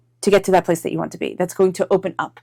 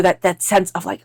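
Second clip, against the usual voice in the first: ordinary talk, nothing marked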